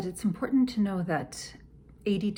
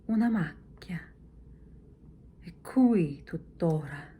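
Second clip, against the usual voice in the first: confused whisper. Shown as a demonstration. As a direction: murmur it, baffled by the spot. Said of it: "So once again".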